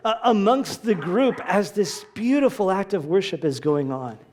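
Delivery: normal talking voice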